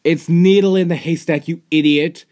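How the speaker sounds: loud, high scolding at the screen; exasperated yell. Real, not acted. Viewer yelling at a pony on screen, exasperated. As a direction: shout the insult at the character.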